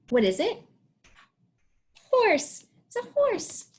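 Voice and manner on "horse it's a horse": delighted praise. Shown as a demonstration. Praising child's answer.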